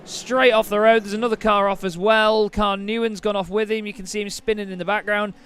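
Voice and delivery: loud, excited race call; motorsport commentator. Acted. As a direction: call the race start at full pitch. Live race play-by-play at start.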